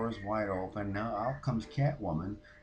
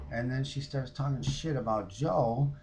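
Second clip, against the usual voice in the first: ordinary talk, nothing marked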